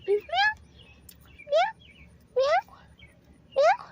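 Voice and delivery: high, loud playful meows at the kitten; cat meows. Pretend. Meowing high to lure the kittens. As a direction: call the kitten with squeaky meows. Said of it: "meowing at the kittens".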